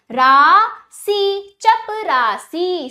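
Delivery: teacher's loud, drawn-out चपरासी; broken, joined pronunciation. Shown as a demonstration. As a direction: stretch रा and सी slowly, then join चपरासी. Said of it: "तोड़कर जोड़कर उच्चारण".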